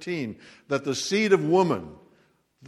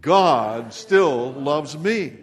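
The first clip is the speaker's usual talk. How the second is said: slow and louder, "God still loves me" leaning on me; louder repeat-after-me; 2 semitones higher, 7 dB louder, much slower than usual. Shown as a demonstration. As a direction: lift the room on the second round. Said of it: "Let's say it again".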